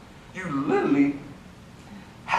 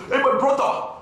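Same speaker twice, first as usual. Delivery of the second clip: put-on higher African-accented English; African woman's accent; 10 semitones higher, 4 dB louder than usual. Teacher imitating African women speaking English, African accent. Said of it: "listen to African women that try to speak English".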